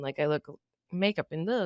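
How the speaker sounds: normal talking voice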